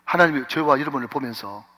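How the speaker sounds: ordinary talk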